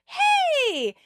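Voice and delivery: high, loud, drawn-out call; shouted greeting. A narrator's reading. Rachel running into Phoebe, shouted greeting. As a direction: holler a long high hey. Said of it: "runs into Phoebe and shouts".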